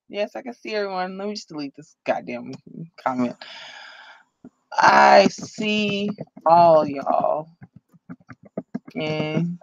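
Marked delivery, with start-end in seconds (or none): 4.7-9.6 slow Southern-style y'all talk; drawn-out, folksy Southern greeting; y'all drawl. Real, not acted. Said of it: "Why am I talking like y'all all of a sudden".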